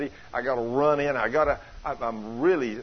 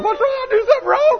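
much higher, laughing mock alarm; flustered man; 8 dB louder than usual. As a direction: squeak out a panicked apology with a laugh. Mimics flustered reply to crying women.

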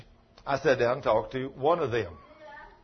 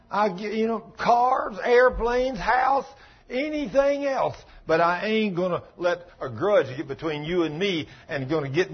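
full-force preaching; 6 semitones higher than usual. Acted. Pastor crying out to his congregation, full-force and impassioned. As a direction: preach it out, building to the peak.

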